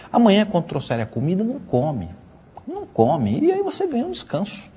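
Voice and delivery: soft, high-swooping donkey giving advice; hushed, lightly acted schemer; lightly voiced advising donkey. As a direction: coax softly, pitch leaping up.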